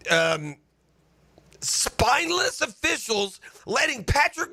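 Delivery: high, heated rising fury at the refs; fed-up fan ranting at spineless officials, high and heated; angry rant. Real, not acted. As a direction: let the outrage climb and boil over. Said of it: "the one that about made me sick".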